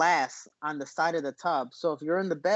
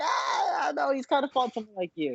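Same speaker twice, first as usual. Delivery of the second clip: much higher, half-shouted sarcastic comeback; sarcastic retort; 9 semitones higher than usual. Retold or imitated. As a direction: toss back a scoffing reply. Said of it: "it's like".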